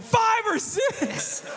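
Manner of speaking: stunned laughing echo; laughing disbelief. Real, not acted. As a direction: echo it back, floored and cracking up. Incredulous repeat of kid's answer.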